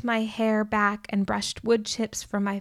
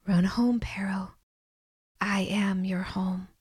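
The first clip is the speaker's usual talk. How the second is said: whisper. A narrator's reading. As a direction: breathe it like a voice within. God's voice speaks softly.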